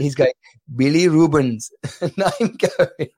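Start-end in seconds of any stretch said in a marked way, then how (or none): 0.7-1.7 lightly imitated Indian accent. Doctor repeating the word to patient, mock Indian accent. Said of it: "In his Indian accent".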